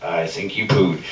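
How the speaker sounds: ordinary talk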